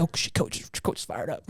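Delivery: low, whispered mock alarm; imitating a nervous whisper; hushed aside. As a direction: whisper it like a wary player. Players' awed reaction, retold.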